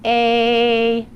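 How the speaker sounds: long A held out like singing; sustained long A. Shown as a demonstration. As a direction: hold the vowel A steady. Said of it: "and say A with me".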